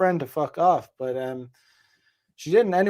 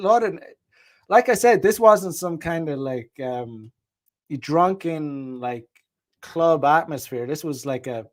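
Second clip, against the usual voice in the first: ordinary talk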